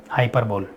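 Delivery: हाइपरबोल said hai-per-bol, pitch falling; low, three-syllable हाइपरबोल ending like "bowl"; common mispronunciation. Shown as a demonstration. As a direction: close हाइपरबोल on a single "bol". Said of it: "क्या पढ़ते हैं?".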